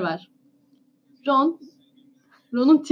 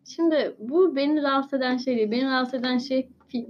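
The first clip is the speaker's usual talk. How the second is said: ordinary delivery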